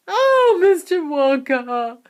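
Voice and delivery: very high, loud anguished cry; desperate plea; cried. A narrator's reading. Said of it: "cried Missus Teavee".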